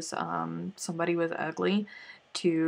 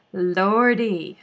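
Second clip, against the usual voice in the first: Southern exclamation; 2 semitones higher, 9 dB louder than usual. Real, not acted. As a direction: belt out Lordy with Southern flair. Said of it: "Southern exclamation".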